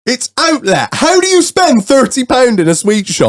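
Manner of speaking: high-pitched disbelieving question; comically appalled at a friend; incredulous. Real, not acted. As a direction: demand an explanation in disbelief. Reacting to friend's big spend.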